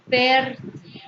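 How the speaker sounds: fértil with a long, strong FÉR, til dropping away; stress on first syllable. Shown as a demonstration. Teacher showing where intensity falls, fértil stressed on FÉR. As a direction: lean hard on FÉR in fértil. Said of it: "se pronuncia con mayor intensidad".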